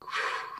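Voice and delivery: soft, tired sigh out; weary sigh. Retold or imitated. Teacher sighs at his class, soft and weary. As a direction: sigh like a teacher having a bad day. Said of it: "kept it low and just kept it kinda low key and just monotone".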